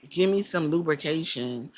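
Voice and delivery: ordinary talk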